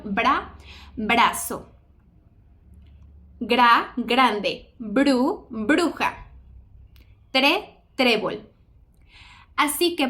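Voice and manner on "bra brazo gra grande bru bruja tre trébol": "bra, brazo, gra, grande, bru, bruja, tre, trébol" syllable then word, tap after the consonant; slow syllable-by-syllable "tre, trébol" with a tapped R; consonant cluster R demo. Shown as a demonstration. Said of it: "Por ejemplo".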